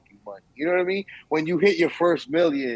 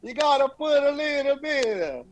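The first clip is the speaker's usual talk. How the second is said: higher, exaggerated Italian lilt; mock Italian accent; 11 semitones higher than usual. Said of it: "That's Italian".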